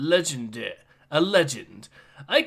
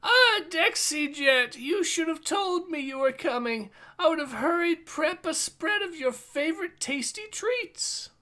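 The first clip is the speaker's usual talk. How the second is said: fawning host character; 9 semitones higher than usual. Acted. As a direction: greet him like an eager innkeeper. Voicing a character greeting.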